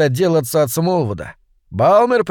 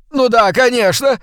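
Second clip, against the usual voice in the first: eager yes; 9 semitones higher, 4 dB louder than usual. Acted. Fat man eager.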